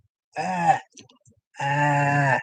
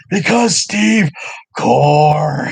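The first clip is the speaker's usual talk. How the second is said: 1 playful shout; 3 semitones higher, 7 dB louder, much slower than usual. Retold or imitated. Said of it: "Why do you say my name like that?".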